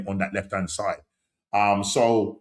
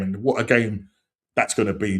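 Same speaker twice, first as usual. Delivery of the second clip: ordinary talk, nothing marked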